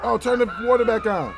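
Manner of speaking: loud, high panicked shout; real panicked yell. Real, not acted. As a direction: yell it out in alarm. Panicking as the game kills him.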